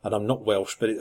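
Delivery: ordinary delivery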